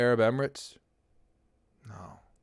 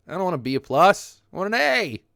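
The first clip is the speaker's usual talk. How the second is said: emphatic insistence; 8 semitones higher, 7 dB louder than usual. Real, not acted. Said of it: "insisting on an A".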